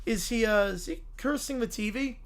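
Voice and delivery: ordinary delivery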